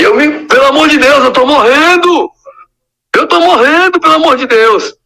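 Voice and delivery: high, loud, panicked begging; desperate pleading. Retold or imitated. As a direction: plead like you can't breathe. Retold suffocating plea in car.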